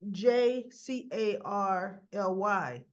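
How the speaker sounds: each letter said slowly, one by one; spelled out slowly. Shown as a demonstration. The host spells the fans' nickname slowly, letter by letter. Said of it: "spelling Carly's name with a J".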